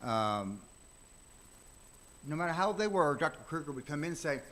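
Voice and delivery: ordinary talk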